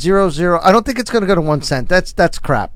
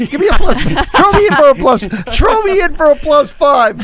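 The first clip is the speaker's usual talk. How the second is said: high, loud, through laughter; laughing plea; 9 semitones higher, 5 dB louder than usual. Real, not acted. Joining the price-guessing game late, laughing plea. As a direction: beg in while cracking up. Joking along with a prediction game.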